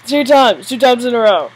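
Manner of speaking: loud, high, thrilled; elated boast. Real, not acted. Player telling viewers of back-to-back wins, elated. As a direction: crow about the repeat win. Elated after winning twice.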